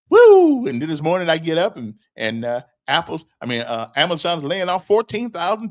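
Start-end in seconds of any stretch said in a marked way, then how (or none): 0.1-0.7 loud high whoop of delight; excited whoop. Real, not acted. Laughing at people fighting in the interview, loud excited whoop. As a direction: throw your head back and let out a big whoop.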